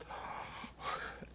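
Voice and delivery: long weary sigh; bored sigh. Shown as a demonstration. Lecturer miming a sleepy audience with a sigh. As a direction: sigh as if dozing off. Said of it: "What is the result?".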